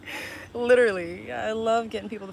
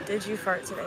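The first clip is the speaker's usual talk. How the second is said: ordinary talk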